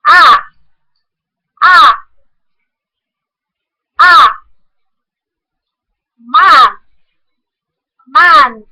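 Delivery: ah called out loud and open; loud vowel drill. Shown as a demonstration. Teacher calls out the vowel loudly. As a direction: belt the open ah. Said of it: "the monophthong".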